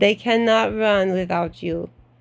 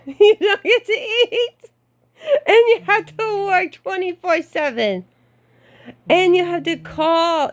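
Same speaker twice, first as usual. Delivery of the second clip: laughing; 10 semitones higher than usual. Real, not acted. Laughing about the job's demands.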